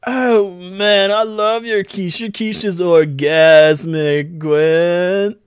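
loud, high, stretched-out rapture; gushing dude. Acted. Felinor praising Gwen's quiche, loud gushing moan. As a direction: moan it out with drawn-out relish.

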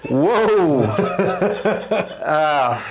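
high, drawn-out whoa breaking into laughter; real shock turning to delight; amazed outburst. Real, not acted. Reacting to sudden back crack.

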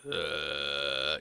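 deep creaky throat rattle; vocal fry. Shown as a demonstration. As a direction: let the throat rattle low. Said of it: "your, like, throat vibrates".

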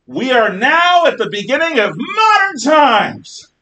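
loud, high ringing proclamation; proclaiming shout. Retold or imitated. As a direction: announce it like a herald. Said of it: "But Bruni says".

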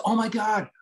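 high, gushing mock excitement; imitating a thrilled fan. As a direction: play the starstruck fan meeting him. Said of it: "She goes".

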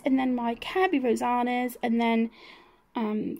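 ordinary talk, nothing marked

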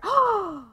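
high, shocked intake of breath; theatrical gasp. Acted. Player dismissed by boss Gus, theatrical gasp. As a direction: gasp as if betrayed. Boss ends their arrangement.

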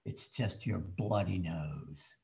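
low, slow mother's voice, lightly done; a dry, unimpressed mom settling it; deadpan mother. Retold or imitated. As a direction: drop low and slow as a matter-of-fact mother. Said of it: "And she said".

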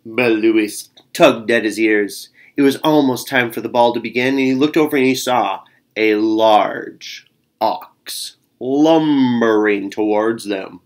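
ordinary delivery, nothing marked

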